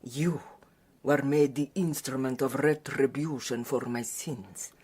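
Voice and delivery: ordinary talk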